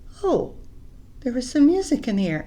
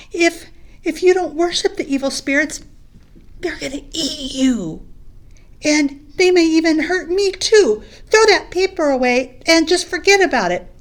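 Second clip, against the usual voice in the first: fearful pleading; 6 semitones higher, 8 dB louder than usual. Acted. Batu fearful of evil spirits.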